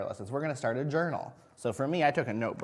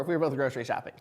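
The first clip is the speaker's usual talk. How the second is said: usual speaking voice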